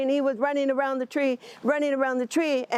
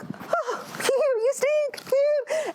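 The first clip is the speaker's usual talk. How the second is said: much higher, taunting sing-song; teasing chipmunk; much slower than usual. Acted. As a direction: needle the skunk like a cheeky brat. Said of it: "he would tease".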